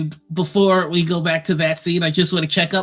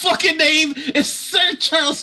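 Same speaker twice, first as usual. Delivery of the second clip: [name] yelling, laughing; 9 semitones higher, faster than usual. Acted. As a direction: shout the threat while cracking up. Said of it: "[name] loves yelling and threatening people".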